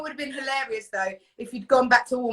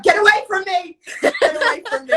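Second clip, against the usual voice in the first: mock panic; 8 semitones higher, 6 dB louder than usual. Imagined panicked shopper.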